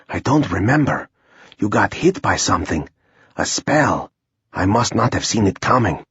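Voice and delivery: lightly put-on companion voice; a hint of an odd little sidekick voice; Loyosh's character voice. Companion telling his boss about the spell, lightly voiced.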